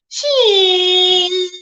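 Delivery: long drawn-out "sheee" of take-off; stretched sound effect, held and gliding; imitated soaring sound. Shown as a demonstration. Vlogger showing fans how the queen takes off, long imitated whoosh. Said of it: "going like this".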